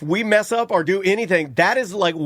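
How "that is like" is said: ordinary delivery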